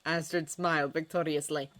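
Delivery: plain reading voice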